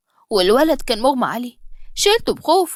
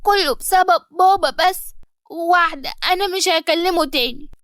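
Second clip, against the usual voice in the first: put-on childlike; 5 semitones higher than usual. Son Ali blaming his father, childish and sulky. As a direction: sulk like a little boy. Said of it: "وقال بطفولة".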